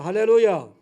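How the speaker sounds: loud ringing hallelujah; shouted hallelujah. Real, not acted. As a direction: call it out to the congregation. Preacher's acclamation. (회중에게 외치는 할렐루야)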